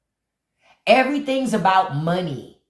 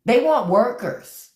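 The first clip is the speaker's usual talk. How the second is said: slow pointed emphasis; 6 semitones higher, slower than usual. Real, not acted. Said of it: "repeats slower".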